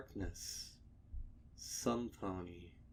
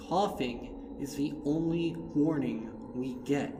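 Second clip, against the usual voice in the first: higher, louder, firm rebuke; stern Twilight; 7 semitones higher, 8 dB louder than usual. Acted. Twilight rebuking Dash, higher and louder, stern. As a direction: scold her friend to get serious. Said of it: "brow furrowed".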